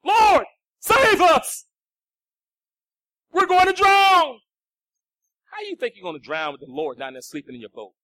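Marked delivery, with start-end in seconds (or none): 0.0-1.7 drawn-out, loud desperate plea; panicked cry for help. Retold or imitated. 3.3-4.4 panicked disciples. Retold or imitated.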